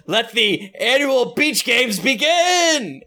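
high, cheery announcer cry; party host shout; excited announcement. Acted. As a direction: kick off the games with glee. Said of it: "and he says".

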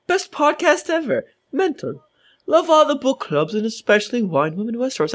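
put-on British accent. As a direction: read it in a cheeky British accent. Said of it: "That was a good accent, [name]".

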